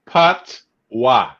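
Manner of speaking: PATOIS in two slow parts, PAT-wah; teaching Patois syllable by syllable; slow correction. Shown as a demonstration. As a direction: say Patois slowly and firmly as PAHT, then WAH. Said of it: "I gotta speak slow".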